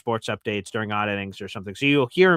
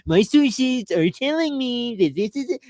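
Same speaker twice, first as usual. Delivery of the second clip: much higher, slow, put-on newsy Adam Schefter voice; Adam Schefter impression; 12 semitones higher, 4 dB louder, slower than usual. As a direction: deliver breaking news like Adam Schefter. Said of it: "a really good Adam Schefter".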